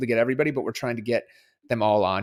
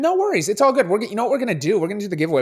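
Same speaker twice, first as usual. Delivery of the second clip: excited; 10 semitones higher, 7 dB louder, much faster than usual. Real, not acted. Host talking excitedly to guest on speaker.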